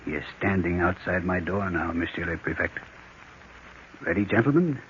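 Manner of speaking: low and careful, the killer is right outside; hushed tension. Acted. Murderer at the door.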